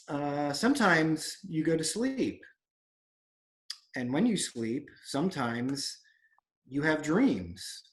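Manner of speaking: slow, with long pauses; retold waiter's deliberate, halting explanation; patient explaining. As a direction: spell it out slowly, phrase by phrase.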